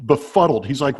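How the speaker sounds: usual speaking voice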